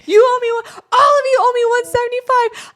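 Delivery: high, loud indignant demand; mock demand. Sister shouting at the family for therapy money. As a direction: wail it like a wronged little sister.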